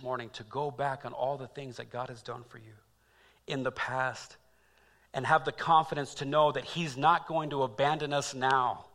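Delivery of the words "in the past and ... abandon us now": ordinary delivery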